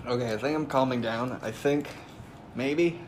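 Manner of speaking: quiet, pained voice; mouth burning from spicy food. Real, not acted. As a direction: talk low through the burn. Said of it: "it's still pretty bad".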